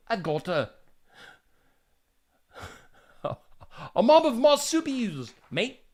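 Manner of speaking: a hammed-up, badly faked Australian accent; a clumsy put-on Australian accent. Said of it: "That's a horrible Australian accent".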